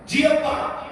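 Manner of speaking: put-on child's reply. Child answers father's call.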